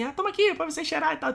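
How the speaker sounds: high sweet saleswoman voice; perfume clerk voice. Retold or imitated. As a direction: offer the sample sweetly. Voicing perfume shop clerk.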